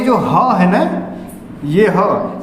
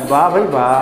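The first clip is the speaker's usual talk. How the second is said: mock praise; 6 semitones lower than usual. Teacher mocks exaggerated student.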